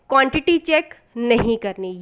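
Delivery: ordinary delivery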